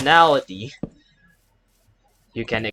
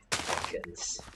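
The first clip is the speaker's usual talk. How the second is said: sighed whisper; 14 dB quieter than usual. Real, not acted. Sighing the monster's name.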